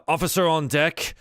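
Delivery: loud, high call to attention; barked command. Acted. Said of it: "and he goes".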